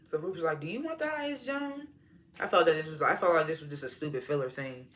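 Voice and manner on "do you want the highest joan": high, soft mock-coy question; teasing question. Ruth needling Joan about the highest, teasing high voice. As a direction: needle her in a high, quiet voice.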